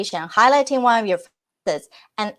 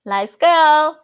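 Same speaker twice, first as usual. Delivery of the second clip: ready to go, higher and louder; eager; 6 semitones higher, 5 dB louder than usual. Real, not acted. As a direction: jump into the game with gusto. Ready for rapid fire round.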